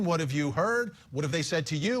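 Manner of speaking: usual speaking voice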